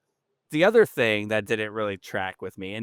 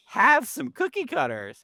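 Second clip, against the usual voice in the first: high, incredulous plea; exasperated plea; 13 semitones higher than usual. Real, not acted. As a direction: throw up your hands at them. Ranting about cookie cutters.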